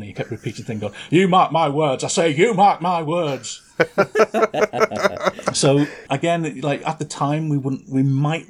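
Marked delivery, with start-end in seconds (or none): 1.1-3.6 loud bellowing Lancashire butcher; Lancastrian foghorn. Retold or imitated. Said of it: "He was like a sort of Lancastrian foghorn leghorn". 3.8-5.5 high burst of laughter; laughing. Real, not acted. Laughing at own impression. 5.5-6.6 said through laughter; laughing. Real, not acted. Laughing after impression.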